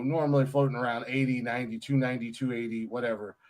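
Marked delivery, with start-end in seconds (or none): none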